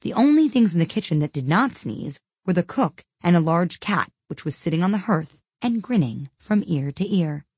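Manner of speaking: usual speaking voice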